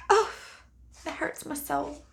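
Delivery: a touch of playful heartbreak; mock heartache. Real, not acted. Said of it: "I'm gonna get emotional".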